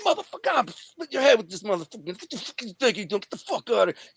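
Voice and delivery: high, strained mock rage; retold furious threat. Storyteller holding the seized crowbar over him, high acted fury.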